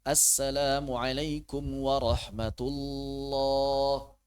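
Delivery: drawn-out, unhurried salam; slow salam. Shown as a demonstration. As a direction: take your time with the greeting. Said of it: "Jangan buru-buru". (salam pelan tidak terburu-buru)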